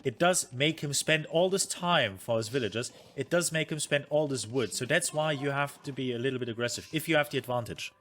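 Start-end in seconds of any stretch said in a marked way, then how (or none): none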